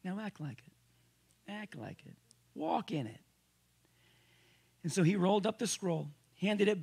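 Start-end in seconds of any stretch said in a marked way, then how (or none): 0.0-3.2 quiet insistence. Acted. Sermon exhortation drop.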